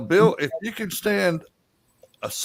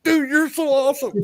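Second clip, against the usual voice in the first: high-pitched fan enthusiasm, acted; gushing delight; 10 semitones higher than usual. Honored friend thanks writer for killing him off, gushing delight. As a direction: gush like a thrilled, honored fan.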